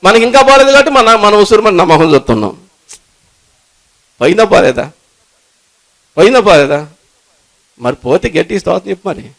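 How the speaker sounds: loud, high, fervent preaching to the church; preaching at full force. Acted. Preacher urging the congregation, at full force. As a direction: preach it out with rising fire.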